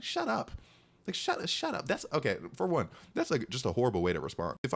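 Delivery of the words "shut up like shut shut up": high, sharp retort of disbelief; exasperated shut up. Retold or imitated. Cutting off a classmate's dumb remark, exasperated. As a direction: snap back at a clueless classmate. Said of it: "I'm like".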